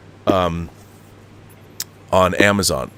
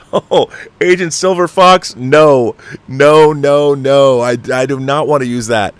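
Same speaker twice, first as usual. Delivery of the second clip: high, loud, stretched "no, no, no"; playfully horrified protest; mock alarmed refusal; 5 semitones higher, 6 dB louder, slower than usual. Real, not acted. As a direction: plead dramatically against the idea.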